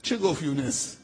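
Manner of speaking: ordinary talk, nothing marked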